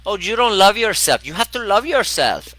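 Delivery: put-on very high, loud Venezuelan-accented exclamation. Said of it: "she's got this beautiful, uh, Venezuelan accent".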